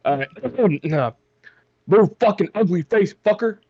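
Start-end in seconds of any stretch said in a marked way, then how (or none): 1.9-3.6 angry yell. Acted. Character cursing at enemy.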